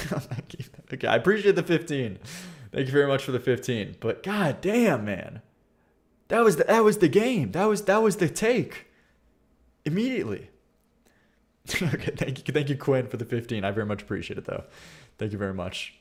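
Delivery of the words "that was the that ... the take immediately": exasperated protest. Real, not acted. Laments the ruined intro take.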